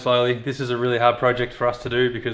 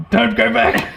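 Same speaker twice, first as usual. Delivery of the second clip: excited shout; 9 semitones higher, 5 dB louder, slower than usual. Real, not acted. Part slipping out of place.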